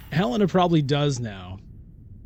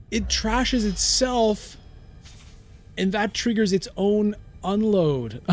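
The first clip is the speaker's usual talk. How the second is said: dawning realization; 6 semitones higher, slower than usual. Real, not acted. Working out the card's trick aloud.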